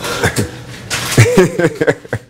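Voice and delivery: high-pitched burst of laughter; real chuckle. Real, not acted. Recalling the inmate hollering about a haunting, amused laughter.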